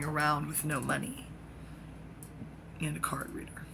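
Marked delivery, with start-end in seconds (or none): 2.9-3.7 whisper. Real, not acted. Trailing hushed aside.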